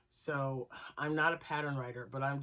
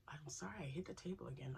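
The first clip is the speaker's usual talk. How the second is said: quick whispered apology; whispered sorry; 10 dB quieter than usual. Real, not acted. Vlogger whispering sorry for bumping the table. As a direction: breathe the apology under your voice.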